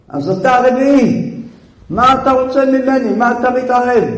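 high, insistent challenge; Joseph rebuking. Acted. Joseph telling Judah off for interfering, indignant rebuke. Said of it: "אומר לו יוסף".

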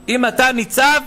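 loud, rising sermon build; fiery preacher climax; preaching. Acted. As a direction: preach it hard to the crowd. Rabbi preaching at full force.

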